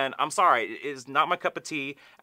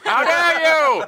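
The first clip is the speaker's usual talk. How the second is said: much higher, much louder mock yell of outrage; mock outraged shout; 12 semitones higher, 10 dB louder than usual. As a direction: throw it out in hammed-up fury. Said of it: "And I was like".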